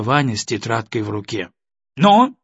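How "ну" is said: требовательное «Ну?», выше и громче; резко потребовать ответа; строго. A narrator's reading. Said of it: "строго посмотрела на сына". (мать строго требует ответа от сына)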